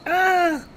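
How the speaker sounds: put-on high girlish scream; man doing a woman's voice. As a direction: squeal like a startled girl. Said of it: "Girl's voice".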